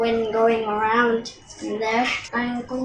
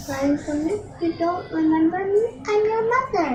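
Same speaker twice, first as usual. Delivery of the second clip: pleading mother; 6 semitones higher than usual. Acted. Mother begs to be recognised.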